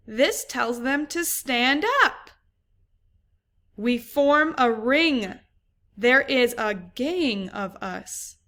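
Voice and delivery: put-on lively storybook read for young pupils; reading to children. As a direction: read it like story time in class. Teacher reads picture book aloud.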